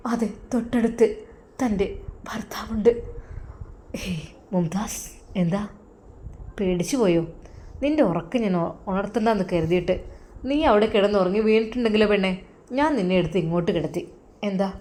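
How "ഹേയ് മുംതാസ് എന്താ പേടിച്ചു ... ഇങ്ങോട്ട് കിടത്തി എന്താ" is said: low, hushed husband teasing his wife; lightly voiced husband, soft and low; husband's voice. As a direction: lower your voice and coax her gently.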